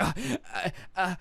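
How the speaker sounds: mock stammer, lost for words; imitated stammering realization. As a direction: stall as if the threat just fell apart. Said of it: "I think she realized".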